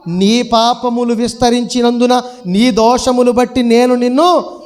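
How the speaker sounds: loud, slow proclamation; forceful scripture reading. Acted. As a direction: declaim God's judgement to the whole congregation. Reads scripture aloud.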